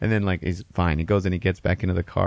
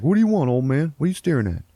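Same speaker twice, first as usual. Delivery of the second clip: higher-pitched confrontational tough guy; mock hostile challenge; 8 semitones higher, 4 dB louder, faster than usual. Driver to the man staring at him, mock hostile. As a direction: challenge him, a bit hammed-up.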